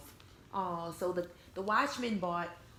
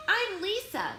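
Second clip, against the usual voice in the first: put-on higher, louder little girl voice; 9 semitones higher, 6 dB louder, slower than usual. Girl Lisa greeting her new bear, higher, louder childlike voice. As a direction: play the eager girl who just bought her bear.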